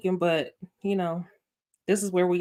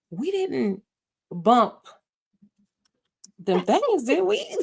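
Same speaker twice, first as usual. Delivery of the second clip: higher, nervous question that climbs to the end; mock-worried Karen; 3 semitones higher, slower than usual. Karen nervously asking Paris about the night, in a mock-worried high voice. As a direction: fret over what happened last night, voice rising. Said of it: "and it was like".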